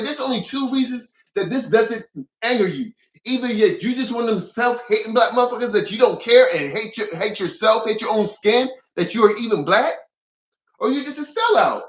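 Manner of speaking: high-pitched, heated accusation; flipping out. Retold or imitated. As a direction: lay out the charge indignantly. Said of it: "I was flipping it".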